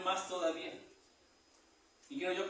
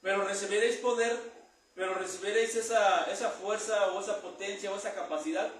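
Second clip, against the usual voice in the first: preaching at full force; 3 semitones higher, 4 dB louder than usual. Acted. Preacher proclaiming the promise of power, loud. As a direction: proclaim it loudly to the congregation.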